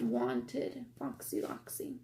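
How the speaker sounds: breathy secret whisper. Acted. Pointing out the wanted poster to kids, whispered.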